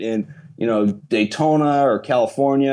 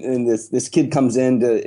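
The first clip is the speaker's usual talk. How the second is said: ordinary delivery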